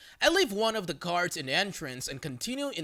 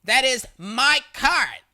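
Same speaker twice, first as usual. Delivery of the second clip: loud, slow, higher near-shout; almost shouting; 6 semitones higher, 8 dB louder, slower than usual. Retold or imitated. Crazy lady to narrator over cart, near-shouting. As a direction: bellow it, claiming the cart. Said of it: "starts to almost shout".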